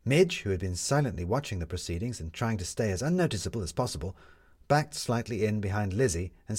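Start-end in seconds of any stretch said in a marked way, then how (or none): none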